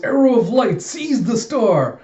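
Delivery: high, loud, drawn-out incantation; hammed-up anime battle cry; calling out an attack. Anya calls out attack name.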